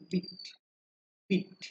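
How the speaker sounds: বিট with a quick short i, as in "bit". Shown as a demonstration. Teacher shows students the short vowel, brief-i বিট. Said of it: "short ই ব্যবহার করা".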